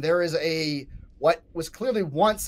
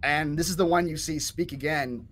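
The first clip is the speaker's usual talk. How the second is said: normal talking voice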